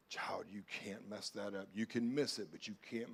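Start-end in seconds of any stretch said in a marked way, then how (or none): 0.1-1.7 put-on hushed whisper as God speaking to his child; soft breathy whisper of reassurance. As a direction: whisper it, tender and close. God's voice whispered to listener.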